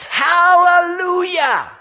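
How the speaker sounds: long, high, drawn-out hallelujah shout; the redeemed shout hallelujah; loud shout. A narrator's reading. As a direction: shout it with your whole chest. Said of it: "shout with a loud voice".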